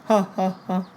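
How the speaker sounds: high, separate ha-ha-ha; spoken ha-ha laugh. Real, not acted. Storyteller who didn't care, high spoken ha-ha laugh. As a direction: laugh it out in high, spaced syllables.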